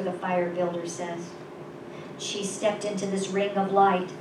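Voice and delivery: usual speaking voice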